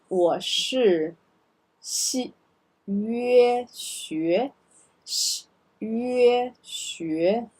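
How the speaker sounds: slow drill of 学 and 生; syllable drill. Shown as a demonstration. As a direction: pinyin drill of 我是学生. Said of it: "Let's do the pinyin together".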